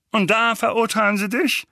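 high-pitched, eager question; Onkel Vernon, eager. Acted. Uncle asks Harry about being sentenced, eager.

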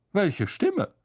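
a vain man caught off guard; Lockhart. Acted.

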